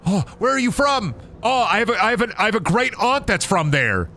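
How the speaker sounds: high, gushing mock enthusiasm; excited Midwesterner. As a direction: imitate a Midwesterner thrilled to meet a European. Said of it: "Midwesterners be like".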